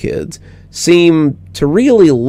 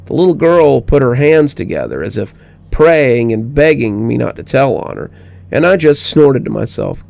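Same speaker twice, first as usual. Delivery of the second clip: usual speaking voice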